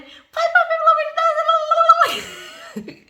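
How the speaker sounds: put-on very high, drawn-out childish plea; begging child. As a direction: wail to daddy for help like a little girl. Kids begging dad.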